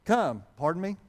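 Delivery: ordinary delivery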